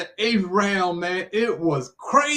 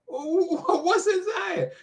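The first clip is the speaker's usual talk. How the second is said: high, drawn-out disbelief with a laugh; astonished; 11 semitones higher, much slower than usual. Retold or imitated. [name] reacting to Ali's plan to fight in Zaire, astonished. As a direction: imitate your own stunned question, pitched way up.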